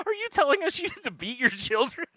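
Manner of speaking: high-pitched incredulous question through laughter; laughing disbelief. Retold or imitated. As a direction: ask it high, cracking up in mock shock. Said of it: "I'm just laughing".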